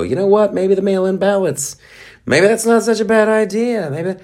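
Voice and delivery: very high sing-song falsetto; mock musing. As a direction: put on a silly high voice, feigning innocence. Voices schemers floating an idea.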